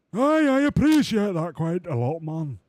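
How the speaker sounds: high, loud, slow cartoonish driver; cheerful driver voice. Streamer voicing the driver to the team boss, high and loud. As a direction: chirp it out like a goofy racing driver.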